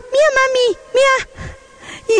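put-on very high toddler voice; excited little child. Toddler showing mom his talc drawings, high child voice. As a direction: imitate a small kid showing off to mom. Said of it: "él me decía".

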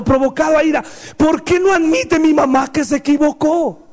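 high, fervent pulpit cry; impassioned preaching. Acted. Preacher crying out to parents to admit mistakes. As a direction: plead with the parents at full force.

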